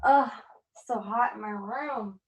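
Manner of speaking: ordinary talk, nothing marked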